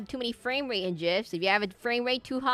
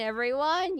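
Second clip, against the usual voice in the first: ordinary delivery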